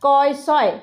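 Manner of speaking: কয় সয় said as the two parts of X; X split into কয় সয়; letter-sound demonstration. Shown as a demonstration. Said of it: "এক্স উচ্চারণ হবে".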